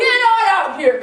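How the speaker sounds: calling loudly to the wings. Acted. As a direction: belt it to bring them onstage. Host calls contestant out.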